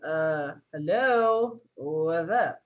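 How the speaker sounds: slow, drawn-out uh hello; drawn-out awkward hello. Retold or imitated. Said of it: "And I'm like".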